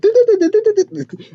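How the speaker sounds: high-pitched stuttering rattle; mock rapid-fire noise. Reviewer showing what the hero can do, high rapid noise.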